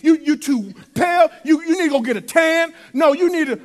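hammed-up nagging about looks; sassy mock messenger. Fake heavenly messenger scolds Mary about her looks, sassy and nagging.

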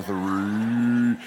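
long stretched-out referee's number; slow-motion count. Retold or imitated. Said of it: "slows down to slow motion".

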